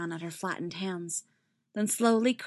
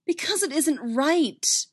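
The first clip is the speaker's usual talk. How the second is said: higher, louder insistence; firm, raised-pitch objection; insistent; 8 semitones higher, 5 dB louder, slower than usual. A narrator's reading. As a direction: insist on it, drawing it out. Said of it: "Ahsoka insisted".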